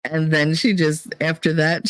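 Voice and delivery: ordinary talk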